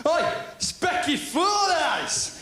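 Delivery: high, slow mocking yell of a bully; bully jeer. Bullies calling out in corridor.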